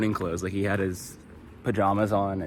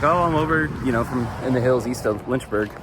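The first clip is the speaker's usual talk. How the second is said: put-on channel an old Virginia fisherman's deep Southern accent; imitating deep Southern accent; 4 semitones higher, 6 dB louder than usual. Hiker retelling the fisherman Joey's answer in a Southern accent. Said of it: "And then I hear his accent".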